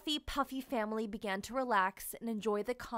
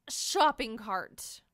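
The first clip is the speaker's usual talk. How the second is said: usual speaking voice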